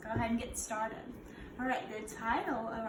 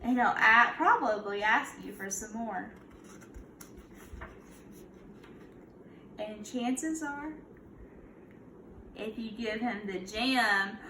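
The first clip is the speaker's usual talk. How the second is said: put-on storybook read-aloud for kids; a gentle teacher reading to her class; reading to children; slower than usual. Teacher reads picture book aloud.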